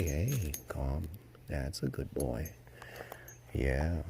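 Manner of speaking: soft, slow, hushed calming to a nervous colt; soothing the horse. Real, not acted. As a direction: settle a jittery yearling gently.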